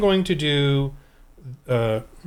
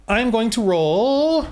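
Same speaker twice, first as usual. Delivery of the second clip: drawn-out, higher announcer-like call; playful announcement; 6 semitones higher, 4 dB louder, much slower than usual. Pretend. Game master announces he is going to roll, drawn-out playful call. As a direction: stretch it out slowly, pitched up.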